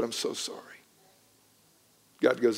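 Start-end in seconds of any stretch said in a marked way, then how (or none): 0.0-0.8 breathy whisper of regret; hushed apology, unvoiced; retold whispered sorry. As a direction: whisper it, choked with remorse. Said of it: "I said".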